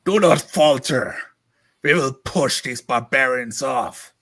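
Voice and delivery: loud commanding chieftain battle cry; rally the troops, full voice; rousing war chief. Acted. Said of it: "The echo of a voice of a chieftain".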